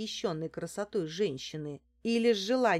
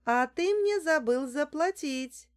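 high, loud retort; shouting back; 8 semitones higher, 5 dB louder than usual. A narrator's reading. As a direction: call back over your shoulder. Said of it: "крикнула я в ответ". (выкрикивая в ответ)

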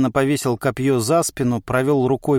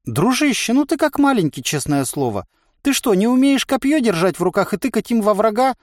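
high-pitched, incredulous inner voice; surprised; 10 semitones higher than usual. A narrator's reading. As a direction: chide him in mild, lilting amazement. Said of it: "удивился голос". (удивлённо, высоким голосом)

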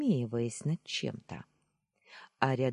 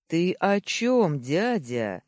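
slow, loud, high demand; enraged outburst; 4 semitones higher, 6 dB louder, slower than usual. A narrator's reading. Nephew growing more enraged at uncle, enraged outburst. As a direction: burst out with mounting rage. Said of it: "выпалил я".

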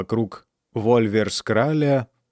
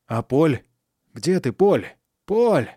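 anxious calling; 4 semitones higher, slower than usual. A narrator's reading. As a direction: call out into the dark, worried. Calling missing friend at night.

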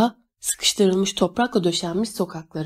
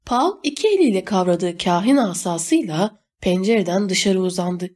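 usual speaking voice

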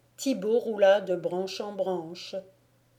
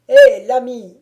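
loud hail up into the tree; baron calling out; 4 semitones higher, 16 dB louder than usual. Acted. Baron hails the peasant up in the tree, loud call. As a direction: shout it across the distance, commanding. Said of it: "cria le baron".

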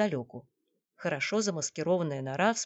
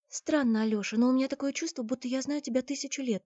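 put-on higher-pitched young woman's confession; Наташа confiding; 8 semitones higher than usual. A narrator's reading. Наташа confesses a feeling to Алёша, higher-pitched admission. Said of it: "призналась Наташа позже".

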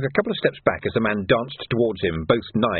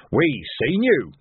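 slow, higher plodding policeman; thick, unshakable copper; 5 semitones higher, slower than usual. A narrator's reading. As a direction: state it slowly like a dim officer. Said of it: "happy in the role of big, thick, but horribly unshakable copper".

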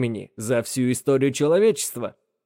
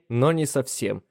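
usual speaking voice

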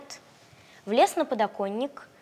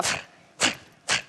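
snorting like an angry cat. Acted. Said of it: "зафыркала".

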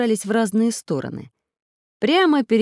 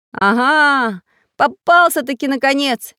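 higher, loud gotcha shout; wild triumphant yell; 8 semitones higher, 7 dB louder than usual. A narrator's reading. Samodelkin jumps up at villain, triumphant shout. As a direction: cry out in triumph. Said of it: "закричал не своим голосом Самоделкин".

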